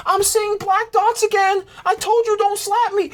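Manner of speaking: mocking girlfriend voice. Girlfriend quoted complaining.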